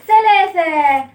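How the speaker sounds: long, loud, drawn-out final count. Shown as a demonstration. Teacher counting to three for the children's answer, loud and drawn-out. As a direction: call out the last number and stretch it.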